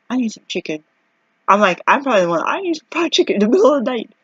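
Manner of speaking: mock craving. Craving chicken at night.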